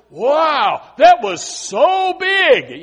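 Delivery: put-on high, slow, amazed child's "wow"; childlike wonder. Preacher voicing a child amazed at something big, slow and high. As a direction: marvel like a small child at something huge. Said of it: "a child says".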